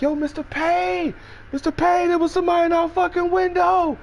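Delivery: very high, calling out; Trim calling. Retold or imitated. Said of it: "come out in the hallway like".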